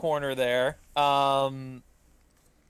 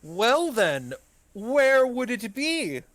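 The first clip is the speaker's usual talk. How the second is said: eager host voice; 8 semitones higher, 4 dB louder, slower than usual. Acted.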